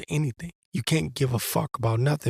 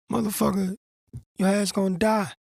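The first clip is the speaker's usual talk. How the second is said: scolding; 7 semitones higher than usual. Acted. Scolding the imagined soldier.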